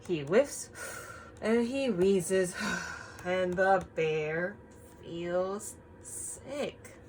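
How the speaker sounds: slow storytime read-aloud; story reading. Acted. Teacher reads the picture book to the class, slowly. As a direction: read it gently to the children.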